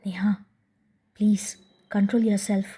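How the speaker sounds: urgent hushed whisper; whispered in her ear. A narrator's reading. Husband whispering in his wife's ear, urging calm. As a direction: whisper so nobody else hears. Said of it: "ಕಿವಿಯಲ್ಲಿ ಪಿಸುಗುಡುವಂತೆ ಹೇಳಿ".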